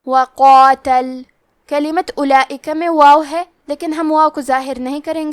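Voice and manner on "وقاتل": "وقاتل" with a heavy qaf and a heavy long alif, slow. Shown as a demonstration. Teacher shows the heavy qaf and alif, slow. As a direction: give the qaf and the alif full weight. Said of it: "دونوں کو موٹی آواز میں پڑھنا ہے".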